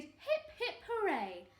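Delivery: triumphant duckbill hurrah; happy hooting. A narrator's reading. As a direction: cheer the hero with a drawn-out flourish. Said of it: "They hooted".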